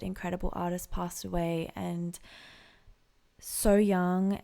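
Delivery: usual speaking voice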